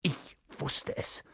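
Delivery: slow, breathy half-whisper; soft, breathless realisation; gasping. A narrator's reading. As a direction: gasp it out under your breath. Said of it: "keuchte Hermine".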